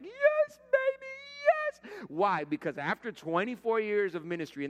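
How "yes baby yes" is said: very high, stretched squeal of delight; ecstatic yes baby. Retold or imitated. Pastor thrilled at fall arriving, squealing yes baby. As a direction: squeal it out like fall finally came. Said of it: "I'm like".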